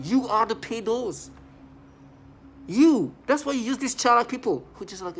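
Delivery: real heated emphasis; angry outburst. Real, not acted. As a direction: snap out the accusation. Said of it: "heated outburst".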